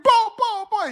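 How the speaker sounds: very high-pitched imitated yelling; high excited mock screams; screaming crowd. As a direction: burst out like a crowd going wild. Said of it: "Everybody just started screaming".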